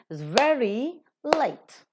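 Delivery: "very" and "late" as two long beats, "is" weak; rhythmic stress. Shown as a demonstration. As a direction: stretch "very", pause, stretch "late". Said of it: "if you are giving a rhythm".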